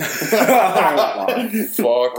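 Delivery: laughing out a disgusted curse. Real, not acted. Disgusted memory of bad bar.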